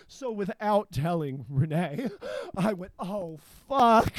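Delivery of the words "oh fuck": loud, higher, sudden oh fuck; startled curse. Retold or imitated. Retelling the bridge falling off, a loud startled curse. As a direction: blurt it out like the bridge just flew off.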